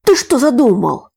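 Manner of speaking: frightened. A narrator's reading. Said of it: "испугался Сашка".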